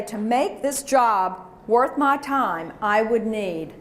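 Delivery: slow, measured phrase; slow deliberate. Shown as a demonstration. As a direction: say it slowly and firmly. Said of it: "Let's practice this all together".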